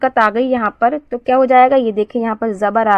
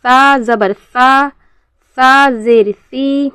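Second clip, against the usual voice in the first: very slow, drawn-out syllable drill; measured spelling-out for learners to copy; model recitation for repeating; much slower than usual. Shown as a demonstration. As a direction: recite each letter and vowel deliberately, holding the long sounds. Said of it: "जिस तरीके से मैं अदा करूँगी".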